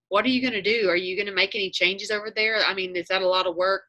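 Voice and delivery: usual speaking voice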